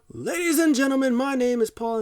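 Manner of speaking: high, loud announcer voice; Paul Heyman impression. Heyman introducing himself to the crowd, hammed-up hype voice. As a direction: belt it out like a ring hype man. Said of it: "Paul Heyman tries to do his thing".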